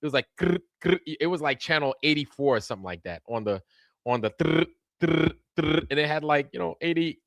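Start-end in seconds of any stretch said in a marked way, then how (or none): 0.4-5.9 dial clicking noise. Retold or imitated. Imitating the channel dial clicking.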